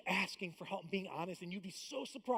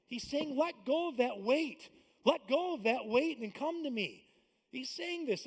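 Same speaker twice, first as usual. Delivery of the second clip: high, loud, driving sermon voice; fervent pulpit delivery, raised and loud; full-force preaching; 7 semitones higher, 9 dB louder than usual. Acted. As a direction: preach it hard to the congregation.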